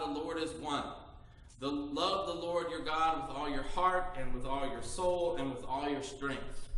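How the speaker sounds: low, measured lesson reading; scripture reading. Acted. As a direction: read it like the Old Testament lesson. Reads Deuteronomy lesson aloud.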